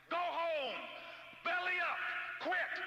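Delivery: very high-pitched, strained cry; high, punchy mock holler; mock yell. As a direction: call out each command separately, way up high. Mock yelling at would-be quitters.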